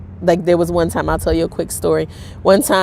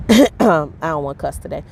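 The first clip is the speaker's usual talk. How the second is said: usual speaking voice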